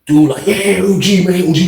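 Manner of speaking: ordinary talk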